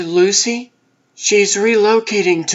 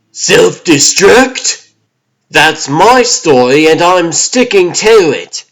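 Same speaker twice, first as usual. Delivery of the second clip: alarmed outburst; 7 dB louder than usual. Acted. Lab tech panics as device dies.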